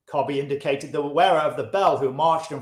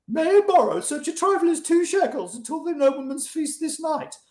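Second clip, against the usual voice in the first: mimicking a friend's plea; 13 semitones higher than usual. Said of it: "and sayest to me".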